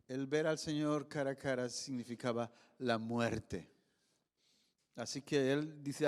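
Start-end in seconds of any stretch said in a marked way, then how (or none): none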